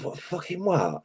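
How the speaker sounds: can't believe what he's hearing; baffled. Retold or imitated. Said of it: "I was like".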